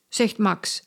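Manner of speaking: ordinary delivery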